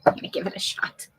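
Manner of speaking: whispered quickly to herself; softly whispered aside. Real, not acted. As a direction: breathe it out, quick and soft.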